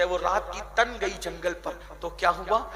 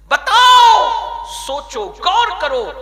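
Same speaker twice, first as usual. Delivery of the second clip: much higher, much louder shouted demand; 13 semitones higher, 14 dB louder, much slower than usual. Real, not acted. Preacher shouts at the congregation to think. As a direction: thunder it at the crowd.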